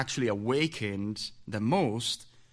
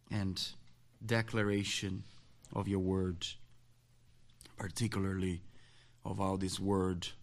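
soft, low prayer voice; 5 semitones lower, 7 dB quieter than usual. Acted. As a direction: pray quietly and reverently. Opening prayer.

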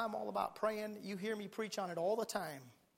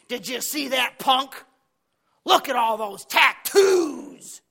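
loud, slow, drawn-out scandalized judging; mock disapproval; 5 semitones higher, 14 dB louder, much slower than usual. Preacher imitating Christians judging a young man, scandalized. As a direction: drag out the offending word, louder and higher. Mimics judgmental Christians passing by.

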